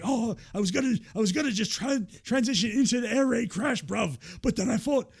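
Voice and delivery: high, loud mock British, bruv and all; imitating Ospreay, hammed-up; British accent impression. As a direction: play up a London lad voice. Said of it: "and he was like".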